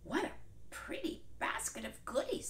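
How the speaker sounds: butter her up sweetly; sweetest voice. Acted. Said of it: "in his sweetest voice".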